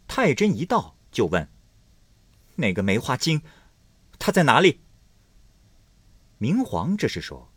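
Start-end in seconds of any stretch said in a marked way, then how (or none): 2.6-4.8 loud, sharp jealous challenge; jealous demand. Acted. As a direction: demand where the rival hides. Jealous consort demands rival. (妒火中烧地质问)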